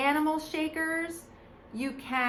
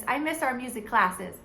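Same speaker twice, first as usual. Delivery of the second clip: ordinary delivery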